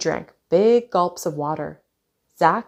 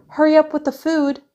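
high, loud holler; 9 semitones higher, 5 dB louder than usual. A narrator's reading. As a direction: call out loudly to hurry someone in another room. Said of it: "she hollered to Zach".

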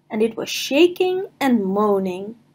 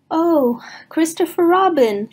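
relieved Pooh; 6 semitones higher than usual. Acted.

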